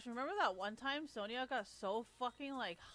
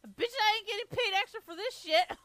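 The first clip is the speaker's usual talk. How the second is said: loud, high indignant yell; hammed-up indignant squawk; mock outrage; 12 semitones higher, 13 dB louder than usual. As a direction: protest loudly like a fed-up worker, played for laughs. Voices outraged worker handling housewives.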